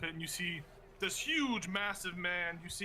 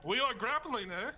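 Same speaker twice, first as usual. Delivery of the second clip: put-on wrestler's Canadian accent; 5 semitones higher, 3 dB louder than usual. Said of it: "That's a good accent".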